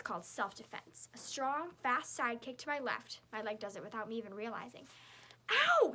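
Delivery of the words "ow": high, loud pained screech; piercing yelp of pain. A narrator's reading. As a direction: shriek as the kick lands. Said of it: "screeches Victoria".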